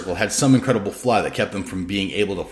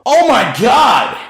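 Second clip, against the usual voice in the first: much higher, louder, slow shout; yelled; 10 dB louder, much slower than usual. A narrator's reading. Hendrix yells to the musicians, a high slow shout. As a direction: yell it out gleefully to the band. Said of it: "Hendrix yelled out".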